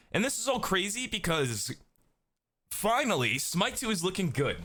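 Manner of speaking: high-pitched, incredulous venting about bad timing; exasperated disbelief. Real, not acted. Exasperated rant about sudden layoffs.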